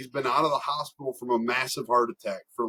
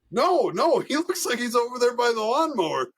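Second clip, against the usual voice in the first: high-pitched, placating teacher voice; imitated flustered teacher; 9 semitones higher than usual. Teacher answers the dad with the oxygen tank, imitated flustered voice. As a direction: mimic a cornered man answering.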